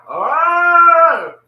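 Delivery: high, loud mock howl; loud yelp. Man imitating his old dog yelling. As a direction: let out a yelping howl.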